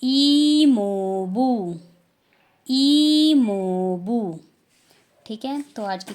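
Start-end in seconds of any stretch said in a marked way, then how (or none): 0.0-4.4 "ईमोबू" very slow and louder; teacher reviewing Korean "ईमोबू"; Korean word. Shown as a demonstration. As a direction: model "ईमोबू" slowly twice. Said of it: "उनको बोलेंगे".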